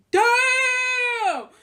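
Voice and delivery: very high, loud, stretched-out exclamation; hammed-up shock, held long and high; astonished shout. Seeing a surprise Cash App payment, astonished shout. Retold shock at the allowance news.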